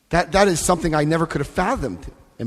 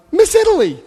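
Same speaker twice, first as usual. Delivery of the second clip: much higher and louder, "Miss Italy" sung out; playful greeting; 5 dB louder than usual. Retold or imitated. Greeting the woman from his flight, high and playful. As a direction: call out to her with delight.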